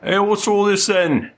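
high, loud cockney guard barking at prisoners; gruff guard. Acted. Guard scolding the prisoners, high and loud. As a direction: play the jailer calling out the noisy cell. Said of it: "a guard comes over and goes".

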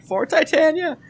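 high-pitched yell at ordinary volume; mock battle cry. Game master voicing a hero charging in, mock battle cry. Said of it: "you yell something like".

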